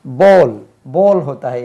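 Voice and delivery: बोल said loudly with a round o; teacher insisting on बोल; emphatic correction. Shown as a demonstration. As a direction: hammer the word बोल. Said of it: "इसका उच्चारण बोल".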